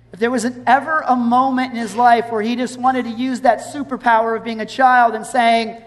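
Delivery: ordinary talk, nothing marked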